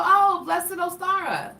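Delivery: high, slow, sugary reply; sweetly pointed reply. Shown as a demonstration. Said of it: "that's how I say it".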